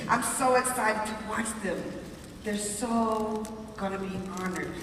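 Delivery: ordinary talk, nothing marked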